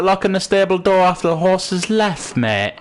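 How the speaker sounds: high-pitched mock East Coast Scots; East Coast Scottish accent. Streamer showing why the accent aggravates, mock East Coast Scottish. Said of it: "it's, it's aggravating".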